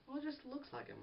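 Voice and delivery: put-on a high, naive girlfriend reply; girlfriend quote. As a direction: her defensive answer in a high mock voice. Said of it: "And she went".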